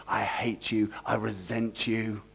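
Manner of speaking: low, quiet muttered hatred; inner resentment. Retold or imitated. Servant's hidden thoughts toward master, low and quiet. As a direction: say it under the breath, bitter. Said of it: "But inwardly".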